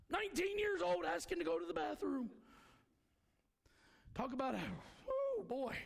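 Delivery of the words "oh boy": exclaiming. Real, not acted.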